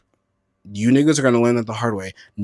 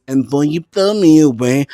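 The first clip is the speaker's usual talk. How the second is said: ordinary talk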